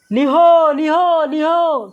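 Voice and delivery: high, loud shout to scare animals; shooing call. Acted. Farmer shouting at nilgai in his field to drive them away, loud high call. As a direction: yell out to chase the beasts off. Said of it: "हल्कू जोर से आवाज़ लगाई".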